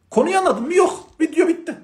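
hammed-up careless student, high and sing-song; mock student. Mocks careless student's answers.

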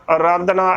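ਆਰਾਧਨਾ with the first vowel cut to a short "a"; common mispronunciation. Shown as a demonstration. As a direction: say ਆਰਾਧਨਾ as "a-raadhna", short opening. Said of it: "ਤਾਂ ਗਲਤ ਉਚਾਰਨ ਹੋਵੇਗਾ".

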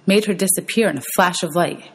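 usual speaking voice

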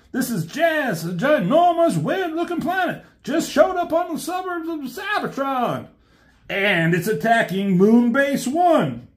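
high, shouted robot message; high cartoon alarm over the radio; distress call. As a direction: report an alien planet in alarm. Jazz's distress message relayed.

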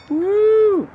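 high, loud little whoop of joy; excited whoop. Real, not acted. Vlogger using his tire arm, excited whoop. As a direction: cheer at the first use of a new tool. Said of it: "I'm excited".

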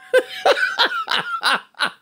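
a sudden high-pitched cackle; high wheezing laugh. Real, not acted. Reacting to how good the girl looks, high cackle. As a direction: burst out in a high delighted laugh. Admiring worker's girlfriend, laughs.

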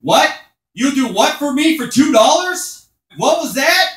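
high, loud, incredulous yelling; outraged shout. Acted. Comic shouting his answer to the lady at the bar. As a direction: bellow the question at the whole bar. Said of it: "I responded to this".

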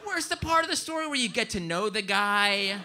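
ordinary delivery

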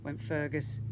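usual speaking voice

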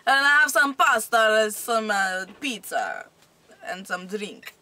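bouncy hammed-up Italian; put-on Italian accent. Shown as a demonstration.

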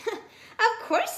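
high, loud laughing reply. Acted. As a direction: chuckle it. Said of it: "sneezed the monkey".

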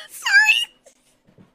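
high, loud real laugh into sorry; can't stop cracking up; bursting laughter. Real, not acted. Reviewer cracking up at the cartoon, loud high laughter. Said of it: "Why am I laughing?".

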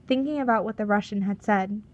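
usual speaking voice

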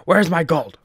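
loud and high, demanding; outraged shout. Real, not acted. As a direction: yell it in outrage. Yelling at bugged shopkeeper.

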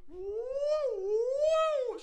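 very high cartoon woo; high cartoon mouse squeak; Mickey Mouse impression. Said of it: "She's Mickey Mouse".

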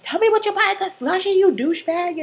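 very high, fast mock outburst; Santana. Santana demanding answers from Sebastian, high hammed-up outburst. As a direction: rush it out high, hammed-up indignation. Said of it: "And she's like".

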